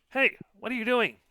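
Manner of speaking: high, loud tiny fairy voice. Acted. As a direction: squeak it like a tiny creature. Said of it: "a tiny voice".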